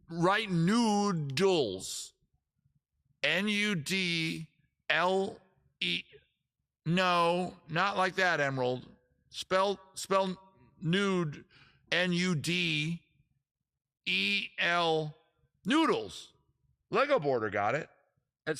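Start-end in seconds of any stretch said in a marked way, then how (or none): 0.1-6.0 drawn-out word, then spaced letters; slow dictation. Real, not acted. Streamer dictating a new word to viewers, slowly. 9.3-16.3 slow letters with pauses between; deliberate spelling out. Real, not acted. Streamer correcting a viewer's spelling, slow and deliberate.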